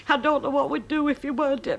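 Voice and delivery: a hint of a weepy young wife; grateful neighbour.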